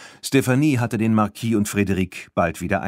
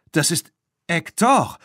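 higher, startled call; surprised call; 8 semitones higher than usual. A narrator's reading. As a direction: recognize the runaway bull, surprised. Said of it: "rief Claire überrascht".

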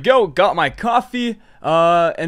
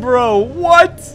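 shocked disbelief; 6 semitones higher, 4 dB louder than usual. Real, not acted. Disbelief after race.

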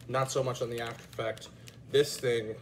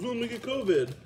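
high, loud mock whine; mocking whiny voice; 10 semitones higher, 4 dB louder, faster than usual. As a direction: mimic a nagging partner. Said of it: "I get yelled at".

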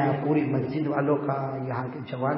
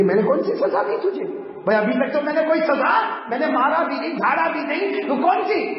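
high, rising indignant questions; acted astonished objection; incredulous protest; 11 semitones higher, 3 dB louder than usual. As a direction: climb higher with each denial.

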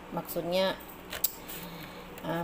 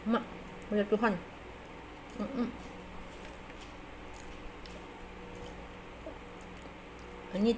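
chewing while talking; speaking through food; mouth full; 2 semitones higher, 9 dB quieter, faster than usual. Real, not acted. Seen 0: Bit sushi before; mouth closed, chewing while speaking, sushi held.

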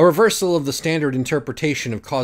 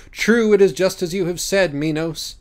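higher, louder ceremonial assent; a lightly voiced dignified judge; solemn agreement; 6 semitones higher, 4 dB louder than usual. Betancourt agreeing with Minos, high and ceremonial.

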